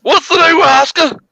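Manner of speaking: high-pitched, indignant outburst; mock outrage. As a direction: cut in with hammed-up high-voiced outrage. Mock outrage interrupting co-host.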